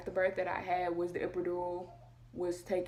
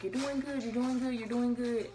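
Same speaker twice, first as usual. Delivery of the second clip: lightly voiced doctor cheering on, higher pitch; encouraging doctor; 5 semitones higher than usual.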